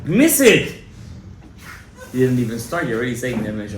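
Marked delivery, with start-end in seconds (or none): 0.0-0.7 loud, high, urgent preaching; preaching at full force. Acted. Rabbi urging the congregation to pray that night, in full preaching force. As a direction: drive every instruction home to the crowd.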